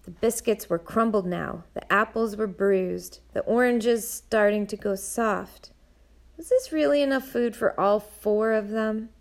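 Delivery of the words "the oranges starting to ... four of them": plain reading voice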